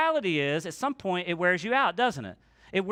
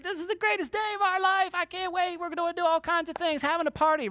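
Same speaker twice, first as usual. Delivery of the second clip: much higher, thrilled and rushed; excited high gush; 12 semitones higher than usual. Retold or imitated. Healed men high-fiving, voiced in excited high gush. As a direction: cheer like a healed man celebrating. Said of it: "They're like".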